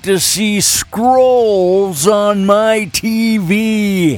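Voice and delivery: put-on drawn-out cranky old man complaint. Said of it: "I will read these with my old man voice".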